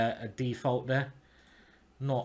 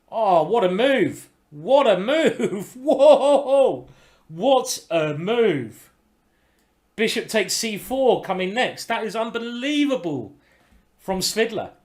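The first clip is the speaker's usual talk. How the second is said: loud, drawn-out cry of amazement with a laugh; real excited commentator's awe; amazed outburst; 6 semitones higher, 7 dB louder, slower than usual. Real, not acted. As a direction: marvel at a brilliant move, voice swooping high. Reacting to a stunning chess move.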